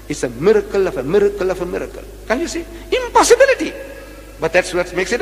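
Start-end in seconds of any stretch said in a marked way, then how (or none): 2.3-3.7 incredulous exclaim. Real, not acted. Preacher rhetorically presses the audience.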